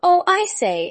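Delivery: put-on bright eager child; little girl voice. A narrator's reading.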